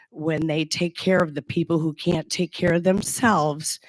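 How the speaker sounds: usual speaking voice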